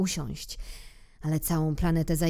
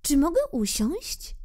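higher, shy request; timid little prince; 10 semitones higher than usual. Acted. Little prince timidly asks king for a seat, higher. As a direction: ask the king politely, unsure. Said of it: "spytał nieśmiało".

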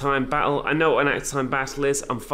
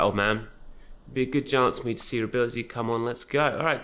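ordinary delivery

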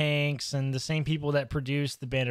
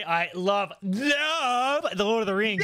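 higher, drawn-out second love; mock gushing; 4 semitones higher, slower than usual. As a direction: imitate a friend's overblown fan devotion. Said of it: "I'm not sure that you can say".

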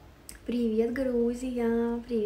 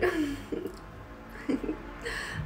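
breathless laugh; 5 semitones higher, 6 dB quieter than usual. Real, not acted. Laughing at chat messages.

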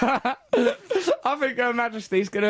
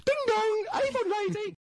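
high sing-song doorstep call; Avon lady; 9 semitones higher, 5 dB quieter than usual. Pretend. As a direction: chirp it like a saleswoman at the door. Doorbell caller voice.

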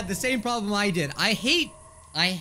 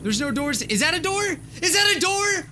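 high-pitched, urgent disbelief, building louder; incredulous outburst; 10 semitones higher, 4 dB louder than usual. Real, not acted. Player suddenly spots the missing door, incredulous. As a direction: blurt it out, pitch shooting way up.

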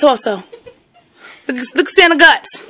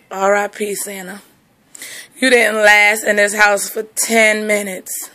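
lower, softer mock mourning; mock eulogy; 8 semitones lower, 5 dB quieter than usual. Owner mourning the toy Santa. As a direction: mourn it like a funeral.

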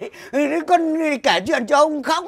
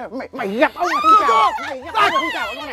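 shrill scolding bully; much faster than usual. Acted.

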